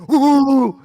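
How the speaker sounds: loud high hooting bark like rowdy fans; mock barking hoot. Mocking a Kentucky fan walking past, loud high hoot. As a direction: whoop it out like a jeering crowd. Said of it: "they'd be like".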